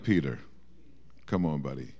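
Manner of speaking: soft, low, tender coaxing; a quiet, kindly nudge; gentle encouragement. Retold or imitated. Jesus quietly encourages Peter across the courtyard, tender. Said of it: "looking at him like".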